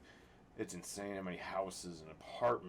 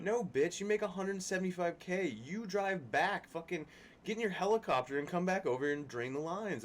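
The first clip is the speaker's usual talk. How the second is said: defiant retort; 9 semitones higher than usual. Retold or imitated. Contractor mocking a builder who left, scornful high retort. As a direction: put the rich builder in his place.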